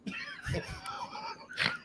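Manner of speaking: real high, drawn-out laugh; high wheezy laugh. Real, not acted. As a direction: crack up helplessly. Laughs at own story.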